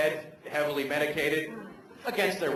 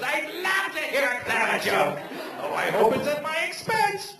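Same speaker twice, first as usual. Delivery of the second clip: sarcastic gushing; 12 semitones higher than usual. Retold or imitated. To a heckler, mock-eager sarcastic gushing. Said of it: "what I actually said was".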